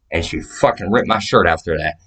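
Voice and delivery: ordinary delivery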